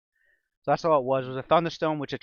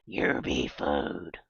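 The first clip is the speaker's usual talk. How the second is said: low goblin threat; 9 semitones lower, much slower than usual. Acted. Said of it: "chanted from shrill, scratchy voices".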